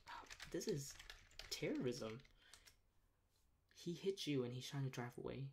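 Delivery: usual speaking voice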